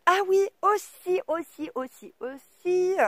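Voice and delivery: high, drawn-out singsong repetition; playful high exclaiming; sudden exclamation. Real, not acted. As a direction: stretch each word as if just remembering.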